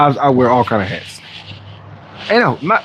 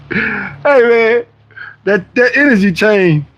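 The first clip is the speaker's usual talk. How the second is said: slow, higher admiring hype voice; mock admirer; 6 semitones higher, much slower than usual. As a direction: put on a fan admiring the chain, slowly.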